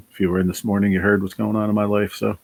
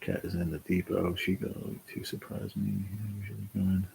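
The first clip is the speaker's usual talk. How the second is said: reading aloud; 12 dB quieter than usual. Shown as a demonstration. Rereading the viewer's chat message aloud, much softer. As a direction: read softly off the screen. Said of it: "What is it?".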